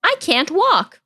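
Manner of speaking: a touch of mock pleading; mock plaintive text. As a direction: act out the blunt text she sent. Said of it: "I sent the message being literally like".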